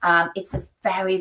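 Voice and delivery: ordinary talk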